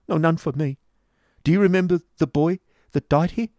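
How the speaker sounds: high, loud, agitated plea; hopeless and urgent, pitched high; despairing boy. A narrator's reading. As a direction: press the question in a high, upset voice. Despairing boy rejects hope, asks urgently.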